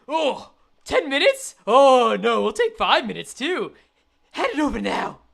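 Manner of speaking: hammed-up villain haggling; greedy thug. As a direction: play a robber raising the demand. Thug voice, then same words reread plain.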